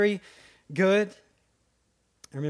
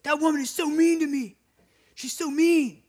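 put-on sulky kid; 9 semitones higher than usual.

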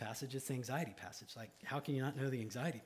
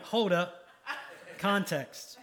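laughing at himself; 6 semitones higher, 7 dB louder, much slower than usual. Retold or imitated. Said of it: "I'm like".

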